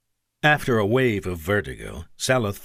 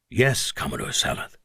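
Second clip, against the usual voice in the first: lower, softer, wounded reply; through a bloody cough; 6 semitones lower, 5 dB quieter than usual. Acted. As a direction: answer weakly through a cough. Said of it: "replied through another bloody cough".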